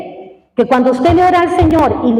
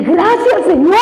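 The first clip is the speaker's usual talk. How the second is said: high, bright burst of thanks; sudden joyful relief; relieved thanks; 5 semitones higher, slower than usual. Retold or imitated. Alivio tras la noticia. (gratitud aliviada y aguda)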